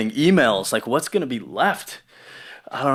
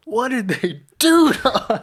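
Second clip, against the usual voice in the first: much higher, hammed-up dismay; mock horror; 14 semitones higher than usual. Friend joking about being sucked back into the Matrix with mock horror. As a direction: wail it like a movie victim.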